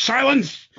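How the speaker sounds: imitating a wrestler's demand; mock command. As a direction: silence the crowd like a villain. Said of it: "he just goes".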